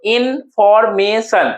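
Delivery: "इन्फॉर्मेशन" slowly, इन, फॉर, मे, शन apart; four syllables. Shown as a demonstration. Teacher counting four syllables for students, slow and separated. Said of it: "चार syllable हो गया".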